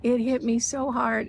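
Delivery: normal talking voice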